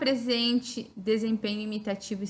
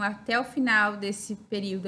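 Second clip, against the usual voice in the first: ordinary talk, nothing marked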